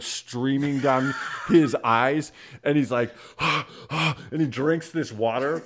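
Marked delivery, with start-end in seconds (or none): 3.2-3.8 high gasp for breath, acted out; mock gasping from burning hot salsa; imitated choking gasp. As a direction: pant like your mouth is on fire.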